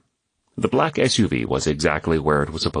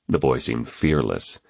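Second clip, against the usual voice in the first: plain reading voice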